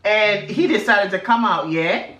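a light mock British accent, ending lines on yeah?. Telling a friend about Big Brother UK in a mock British accent. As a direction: put on a cheeky UK accent, tag each line with yeah. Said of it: "you don't have to say yeah after every sentence".

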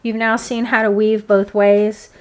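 usual speaking voice

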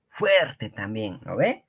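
usual speaking voice

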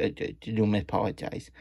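plain reading voice

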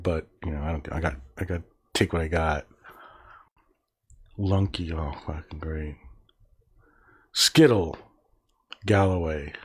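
ordinary talk

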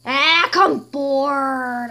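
whiny bored queen; bored whine. Acted. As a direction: groan out the boredom. Queen sitting bored on throne.

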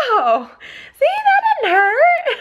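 very high, drawn-out squeak; singsong it like a reassured little boy; high-pitched mock relief; imitating relieved child. Same relieved child voice, second shot.

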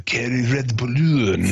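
halting mock Japanese accent; hammed-up, broken-English Japanese voice; Japanese impression. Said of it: "Stu trying to do fucking Japanese impression".

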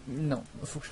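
ordinary delivery

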